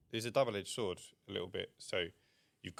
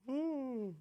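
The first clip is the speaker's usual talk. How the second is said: high ooh. Real, not acted. Wince at lost cash.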